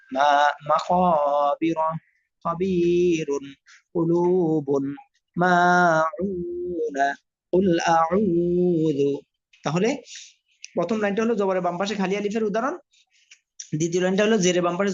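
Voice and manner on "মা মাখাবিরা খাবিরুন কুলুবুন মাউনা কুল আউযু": very slow, long vowels in মাখাবিরা and মাউনা; drawn-out madd reading. Shown as a demonstration. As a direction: stretch each madd vowel, as in খাবিরুন. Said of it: "মাদ অর্থ টেনে পড়া".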